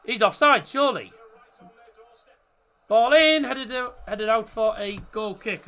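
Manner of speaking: loud, high burst of play-by-play; real live football excitement; excited commentary. Real, not acted. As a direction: call the goal chance as it happens, voice jumping up. Live commentary on a goal chance.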